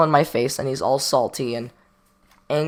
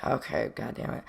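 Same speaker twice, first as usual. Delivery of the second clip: whispered curse to himself; a sinking whisper, bracing for trouble; muttered curse; 5 semitones lower, 14 dB quieter than usual. Retold or imitated. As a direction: hiss it under your breath as trouble comes. Dreading the kids approaching.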